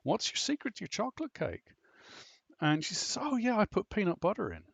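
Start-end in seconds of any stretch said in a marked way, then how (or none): 0.0-1.7 high, loud gushing praise, retold; gushing teachers. Teachers gushing over cake. 3.2-4.6 high, bright-eyed casual answer, retold; breezy mother.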